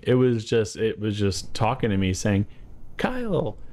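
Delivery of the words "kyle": high-pitched comic taunting voice; taunting soup can. Acted. Soup can tempting driver to trade his birthright, high taunting voice. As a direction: tempt him in a silly, high talking-object voice. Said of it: "The soup was taunting me".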